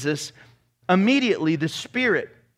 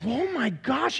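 usual speaking voice

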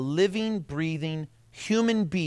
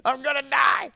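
shrieking high panic, much higher and louder; mock hysterical cry for help; panicked self; 9 semitones higher, 6 dB louder than usual. To his wife, sure he's dying, in a high panicked shout. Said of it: "I'm like".

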